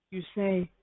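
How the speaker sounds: ordinary delivery, nothing marked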